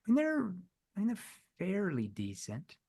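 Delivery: dropping soft and hesitant on fairly decent; seed doubt softly; concerned tone. Shown as a demonstration. Salesman softly doubting the prospect's firm, concerned. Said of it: "I used the concerned tone".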